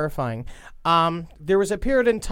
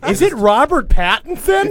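excited squeal; 9 semitones higher, 7 dB louder than usual. Acted. Excited mock swoon.

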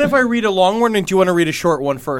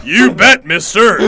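masculine woman voice; 5 dB louder, slower than usual. Acted. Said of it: "Real masculine woman".

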